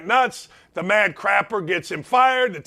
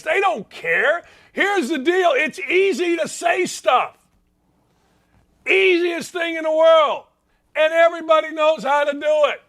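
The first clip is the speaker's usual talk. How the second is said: building rant; 6 semitones higher than usual. Real, not acted. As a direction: climb into an angry shout. Ranting, far shout, building.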